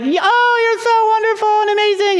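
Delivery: very high, syrupy imitation; gushing grandma. Grandma praising her grandson, gushing in a very high voice. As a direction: coo in an exaggerated high voice. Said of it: "No, she's like".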